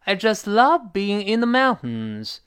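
"just love" lifted high; emphatic just. Shown as a demonstration. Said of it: "加强语气".

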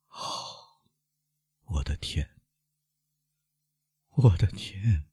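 soft dismayed murmur, rising a little on the repeat; low, dejected voice. A narrator's reading. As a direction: start almost under the breath, despondent. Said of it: "威勒夫低声地说， 十分沮丧". (低声沮丧地说)

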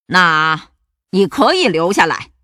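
loud, weighty pirate chief; pirate captain. A narrator's reading. Pirate captain letting the monster stay, loud and commanding. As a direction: pronounce the verdict like a boss. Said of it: "头目汉克说".

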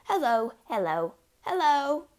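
high, loud parrot hellos. A narrator's reading. Hidden parrot calls as the family creeps closer, high calling. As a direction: call out like a parrot. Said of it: "came the voice again".

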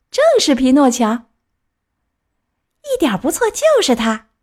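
high, emphatic cry of recognition; clown calling out. A narrator's reading. Hunchback clown confirms it is Pinocchio, calling out high. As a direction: call it out high and sure. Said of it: "驼背小丑叫道".

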